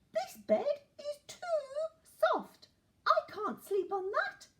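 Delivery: very high, whiny little character voice; complaining Goldilocks. A narrator's reading. Goldilocks rejects the too-hard bed, high-pitched complaining. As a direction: pipe up in a high, loud complaint.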